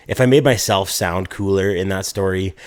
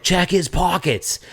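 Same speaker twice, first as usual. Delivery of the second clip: high, drawn-out mock yell; retold yell; 9 semitones higher, slower than usual. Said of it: "fucking yelling".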